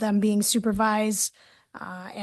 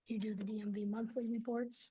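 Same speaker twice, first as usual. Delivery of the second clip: much softer, off to the side; quiet aside; 14 dB quieter than usual. Real, not acted. Presenter checks with co-presenter who does the reports, quiet aside. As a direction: drop your volume to check with a colleague.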